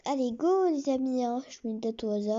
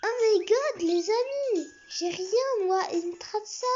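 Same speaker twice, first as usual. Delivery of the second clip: excited; 7 semitones higher, 6 dB louder than usual. Real, not acted. Amazed by a generous trade.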